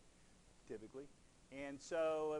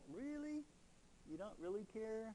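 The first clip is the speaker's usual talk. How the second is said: high incredulous "Really?"; mock disbelief; 11 semitones higher than usual. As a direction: jump up in pitch, genuinely taken aback. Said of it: "I'm like".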